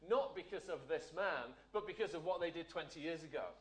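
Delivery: ordinary talk, nothing marked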